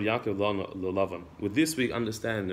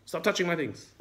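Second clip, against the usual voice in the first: pitched-up indignant complaint; acted outrage, voice climbing high; angry Yaakov; 6 semitones higher than usual. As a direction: Yaakov fed up with Lavan's searching. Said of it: "Yaakov gets all angry".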